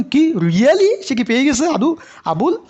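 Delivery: high, loud, stretched "really?" in astonishment; mock disbelief. মজা করে অবাক হয়ে জোরে প্রশ্ন. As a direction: stretch out the shock at the news. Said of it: "কেউ যখন এ প্লাস পেয়ে গেছে".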